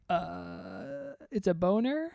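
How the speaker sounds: long quiet "uh", rising question; unsure guess. Retold or imitated. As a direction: guess cluelessly, pitch climbing. Retells his own clueless guess.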